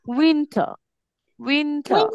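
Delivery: উইন্টার as wintha, no r; no final r. Shown as a demonstration. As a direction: drop the r at the end of উইন্টার. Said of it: "শেষে আর থাকলে আমরা উচ্চারণ করব না". (উইন্টার, শেষের র ছাড়া)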